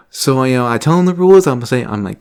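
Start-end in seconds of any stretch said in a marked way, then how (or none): none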